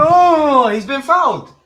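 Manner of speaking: high whiny mock complaint; hammed-up fake outrage; mocking a diving player. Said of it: "the man goes".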